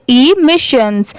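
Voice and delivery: इमिशंस slowly, initial E sounded separately as "ee". Shown as a demonstration. Teacher showing learners how to read the word, इमिशंस slowly with a separate "ee". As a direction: draw out इमिशंस as "ee-mishans". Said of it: "ई letter की sound separate आ रही है".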